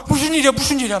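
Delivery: a panicked mom demanding answers; alarmed mother. Retold or imitated. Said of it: "엄마가".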